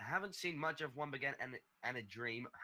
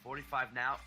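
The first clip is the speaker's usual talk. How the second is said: play-by-play; 4 semitones higher, 3 dB louder than usual. Acted. Live match commentary.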